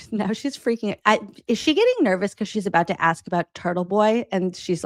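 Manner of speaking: ordinary delivery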